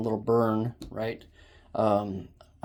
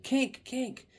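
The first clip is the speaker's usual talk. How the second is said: retold call-out. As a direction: imitate calling out a snag to a helper. Retold calling out hose problem.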